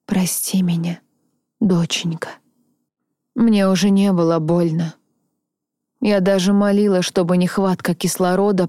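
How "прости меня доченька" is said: еле слышный шёпот задыхаясь; прошепчи одними губами. Acted. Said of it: "прошептать одними губами". (задыхающийся шёпот)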